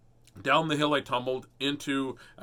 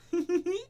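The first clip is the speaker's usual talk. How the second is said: high little piglet giggle; George's giggle. A narrator's reading. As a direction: giggle high like a tiny child. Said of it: "Laughs George".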